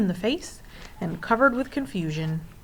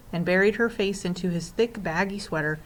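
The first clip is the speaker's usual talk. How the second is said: ordinary delivery, nothing marked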